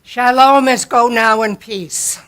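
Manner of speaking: ordinary delivery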